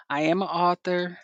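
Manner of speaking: normal talking voice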